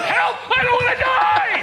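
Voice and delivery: high, loud panicked scream. Retold or imitated. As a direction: scream for your life. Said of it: "I'm screaming my native".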